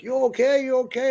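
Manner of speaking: a fellow trucker checking on him, lightly voiced with concern. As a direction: ask it like a worried stranger leaning in. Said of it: "he's like".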